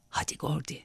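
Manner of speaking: put-on soft breathy whisper to her drunk companion. A narrator's reading. Girl urging drunk Gordy to leave, hushed whisper. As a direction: lean in and whisper it. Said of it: "diye fısıldadı".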